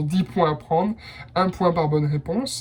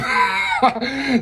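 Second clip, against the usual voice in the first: burst of laughter; 7 semitones higher than usual. Real, not acted. Bursts out laughing mid-sentence.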